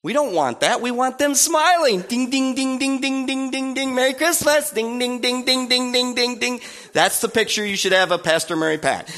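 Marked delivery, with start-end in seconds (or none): 2.0-3.9 sing-song ding ding of a bell ringer. Retold or imitated. Bell ringer at the store door chiming ding ding. 3.9-4.7 high, bright Merry Christmas greeting; cheery bell ringer. Retold or imitated. Bell ringer outside the store, high and cheery. 4.7-6.6 sing-song ding ding of a bell ringer. Retold or imitated. Bell ringer at the store door chiming ding ding.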